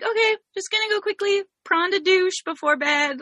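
high, sing-out bedtime announcement; playful self-quote. Retold or imitated. Host announcing a shower to her household, playfully.

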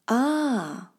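long, held Ah of understanding; drawn-out exclamation. Shown as a demonstration. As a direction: stretch Ah out as it dawns on you. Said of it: "is pronounced longer than usual".

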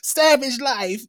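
slow high drawl imitating the daughter. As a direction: stretch it out, girlish and mocking. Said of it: "sounds like the female version of [name]".

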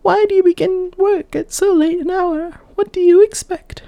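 put-on a young maiden, high and light, asking; high girlish maiden voice. Maiden asking the old woman, high girlish voice. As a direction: lift into a bright girl's voice.

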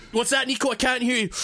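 normal talking voice

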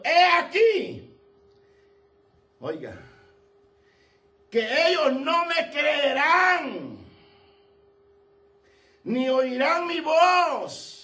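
high, slow, loud protest; voiced Moses. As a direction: plead slowly as Moses, straining up. (Moisés protestando despacio y agudo)

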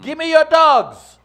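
high, loud, drawn-out New York demand; New York accent. Shown as a demonstration. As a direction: demand it like a pushy New Yorker. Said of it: "working on my New York accent and attitude".

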